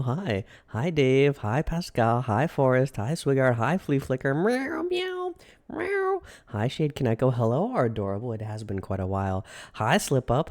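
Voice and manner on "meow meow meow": very high squeaky kitty meows; squeaky cartoon cat meow. Streamer meows at viewers.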